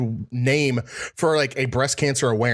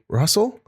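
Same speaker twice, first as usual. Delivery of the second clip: "Russell" with a rising, higher pitch; questioning tone; 7 semitones higher than usual. Retold or imitated. Background caller checking who answered, "Russell" rising as a question. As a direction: ask "Russell" as if unsure who's there. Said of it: "Like they're asking".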